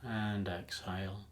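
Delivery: unhurried meditation voice with long pauses; calm, even hypnotic lead-in, phrase by phrase; guided meditation. Acted.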